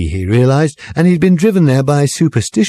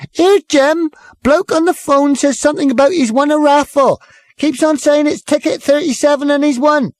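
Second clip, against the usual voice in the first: barmaid calling out. A narrator's reading. Barmaid calling across the noisy bar to the landlord. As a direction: call over the bar noise to Jim. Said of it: "called over the noisy bar".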